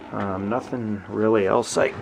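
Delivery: ordinary talk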